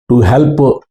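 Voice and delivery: ordinary talk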